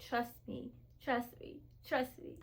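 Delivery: very soft, hushed divine voice; still small voice. Retold or imitated. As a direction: murmur it like a gentle inner voice. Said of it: "God kept telling me".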